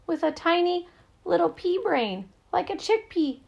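high-pitched panic, pouring out; panicked. A narrator's reading. Rodney to Rafter about losing his brain power, panicked. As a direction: let the voice climb high with panic. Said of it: "His voice filled with panic".

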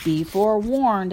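loud, firm warning; stern warning. A narrator's reading. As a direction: warn the intruder sternly. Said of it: "I bark".

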